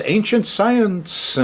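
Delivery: high and slow ancient science; emphatic, drawn out. Real, not acted. Astrologer insisting to his viewers, slow and emphatic.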